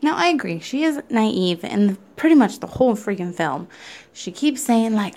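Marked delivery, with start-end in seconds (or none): none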